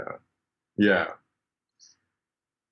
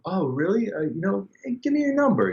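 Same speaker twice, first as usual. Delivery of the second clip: retold eager excitement, pitched much higher and climbing; 13 semitones higher than usual. Guy hearing he bows upright bass, eagerly asking for his number. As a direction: swept up in excitement, voice shooting up high.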